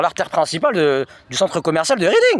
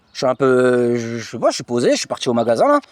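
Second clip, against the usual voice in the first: acted innocence; 6 semitones lower than usual. Acting himself explaining innocently to police.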